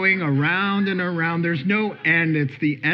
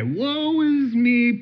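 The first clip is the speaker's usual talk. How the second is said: self-pitying whine; 7 semitones higher, much slower than usual. Acted. Mocking a worrier's self-pity.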